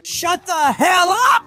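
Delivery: high, drawn-out angry yell; exasperated yell. Acted. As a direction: stretch the order out, rising high. Silencing the crier.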